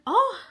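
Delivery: high, loud "oh!" of alarm; mock startled cry; re-enacted fright cry. Vlogger retelling flickering lights, high mock fright cry.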